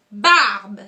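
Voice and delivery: barbe called out loud with the gesture; loud demo shout. Shown as a demonstration. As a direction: call out barbe loud as you move. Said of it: "on y va comme ça".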